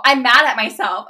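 loud, hammed-up outburst; retold anger. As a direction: blurt it loudly, mock-exasperated. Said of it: "I was so pissed at myself".

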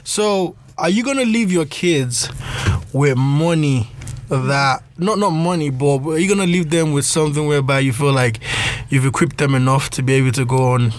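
ordinary delivery

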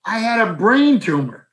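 high, squeaky outraged friend; Mark's high indignant squawk. Retold or imitated. Old friend on the phone, shocked and high-pitched. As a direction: play a buddy hurt he wasn't told. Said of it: "I said".

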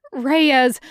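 loud call of a name. Acted. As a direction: call him sharply across the room. Calling out the ghost's name.

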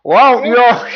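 high, loud words through laughter; can barely speak for laughing. Real, not acted. Laughing trying to recall quote.

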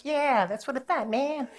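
high, smug mock gangster; lightly voiced cocky tough; smug gangster sneer. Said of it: "the guy's like".

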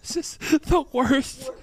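high-pitched slow laugh-crying; laughing so hard it sounds like sobbing. Real, not acted. Hysterical laughter-crying fit.

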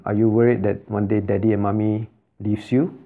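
ordinary talk

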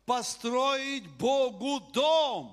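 rising preacher's joy; preaching fervour. Real, not acted. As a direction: lift the congregation with it. Preacher exulting over David's dream.

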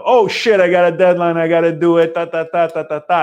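hammed-up frantic urgency; stressed rush. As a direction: rattle it off in a mounting fluster. Acting out deadline stress.

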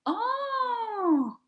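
very high, drawn-out mock "Oh"; sing-song high surprised oh; the famous oh. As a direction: imitate the clerks' fascinated oh. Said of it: "we used to call it the famous "oh".

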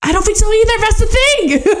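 高声笑着附和; high, laughing agreement. Real, not acted. Eager agreement with co-host.

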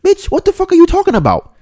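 hammed-up outraged yell; incredulous yell. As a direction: shout it in disbelief at her. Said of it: "Jamaicans pulled up in the room and was like".

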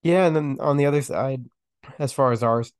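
raspy, sick voice; hoarse with a cold. Real, not acted. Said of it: "excuse the, uh, the raspy voice".

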